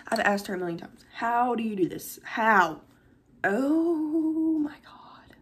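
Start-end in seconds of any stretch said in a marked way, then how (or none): 3.4-5.4 long drawn-out, high breath of amazement; real awe. Real, not acted. Vlogger looking at handmade cup, drawn-out awed exclamation. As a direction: stretch it out, overwhelmed.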